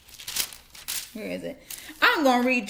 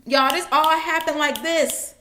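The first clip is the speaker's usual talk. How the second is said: ordinary talk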